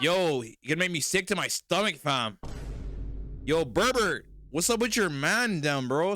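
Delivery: high, loud and drawn out; loud streamer hype. Real, not acted. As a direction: shout it across the room. Streamer calling out to chat.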